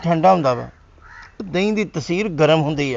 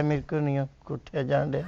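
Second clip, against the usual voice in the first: much softer, weak mumble with a stifled laugh; feeble fake sickness; 4 semitones lower, 11 dB quieter than usual. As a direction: fake being too ill to move. Husband feigning sickness. (ਕਮਜ਼ੋਰ ਨਕਲੀ ਬਿਮਾਰ ਆਵਾਜ਼)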